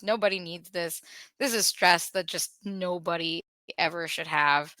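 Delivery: usual speaking voice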